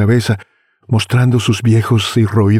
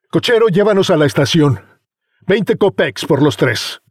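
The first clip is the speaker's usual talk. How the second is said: put-on higher brash young man voice; young man hailing; 7 semitones higher than usual. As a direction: call out to the driver. Young customer hails the driver.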